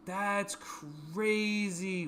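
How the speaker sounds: very slow stretched out; drawn out. Real, not acted. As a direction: stretch it in disbelief. Drawn-out amazement.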